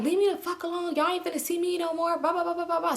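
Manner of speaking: high-pitched huffy brush-off; mock angry retort. [name] telling friends to leave her alone, mock angry.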